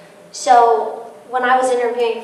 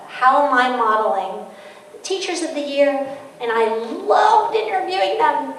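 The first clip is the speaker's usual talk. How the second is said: ordinary delivery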